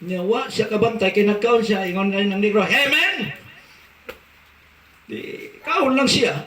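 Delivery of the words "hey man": high American-style hey man; mock American call. American man calling to the diner, high friendly call. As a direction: call out to a stranger, American swagger.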